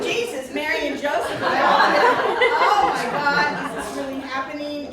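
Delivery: loud laughing exclamations; laughing disbelief. Real, not acted. Speaker laughing at a friend's Bible rewrite, loud disbelief. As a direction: laugh out your own shock. Laughing at her own shocked reaction.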